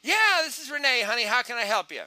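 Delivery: high, loud, bubbly phone greeting; upbeat call answerer. Shown as a demonstration. Callee answering a sales call, upbeat and loud. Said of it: "I hear on the other line".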